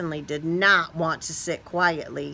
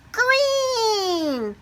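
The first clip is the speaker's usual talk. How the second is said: ta-da the new colour; excited reveal. A narrator's reading.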